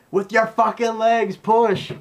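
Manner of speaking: high, loud, slow yell; straining shout. Retold or imitated. Urging Kenner to push with his legs, straining high yell. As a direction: yell the order as if pushing hard. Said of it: "You know".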